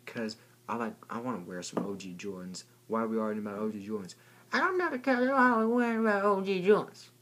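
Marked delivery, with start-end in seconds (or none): 4.5-7.1 high, louder squeaky protest; squeaky kid insisting; weird high voice. Pretend. Squeaky alter ego arguing about sneakers, weird high voice.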